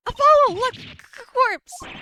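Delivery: loud, high stammered cry; acted alarm. Calling Apollo on finding a corpse, alarmed high shout. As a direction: shout it high and trip over the "C". Character finds a corpse, cries out.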